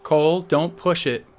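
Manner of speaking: loud, high motherly warning; bellowed warning. A narrator's reading. Mom to her son from the hall, bellowed. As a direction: call a sharp warning down the hall. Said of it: "Mom bellowed from the hall".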